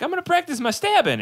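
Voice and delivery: high, squeaky Fry impression; Fry quote voice. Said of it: "when he's like".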